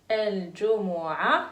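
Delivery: الجمعة said slowly with no ta at the end; careful pronunciation. Shown as a demonstration. Teacher shows the children the ending of الجمعة. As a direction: stop الجمعة on the vowel, dropping the ta. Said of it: "On ne prononce pas ta à la fin".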